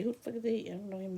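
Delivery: ordinary delivery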